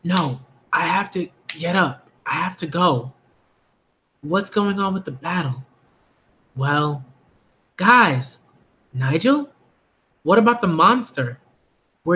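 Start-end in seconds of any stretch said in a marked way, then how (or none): none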